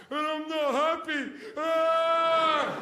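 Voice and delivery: high, mocking whine ending in a yelp; hammed-up whinge with a loud cry; whiny spoiled westerner. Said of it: "seven hundred channels going".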